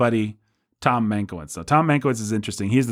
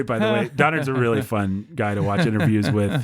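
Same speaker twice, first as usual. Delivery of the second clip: ordinary talk, nothing marked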